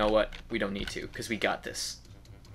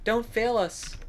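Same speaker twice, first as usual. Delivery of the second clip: sweet cheerleader voice; 8 semitones higher than usual. Pretend. Cheering enemy on, cutesy.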